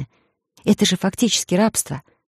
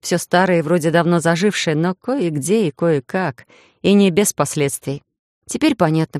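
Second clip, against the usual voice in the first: usual speaking voice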